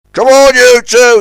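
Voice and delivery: loud high snap; snapping. A narrator's reading. Scuttlebutt Pete snapping at the switchers, loud. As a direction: snap at them. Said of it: "he snapped".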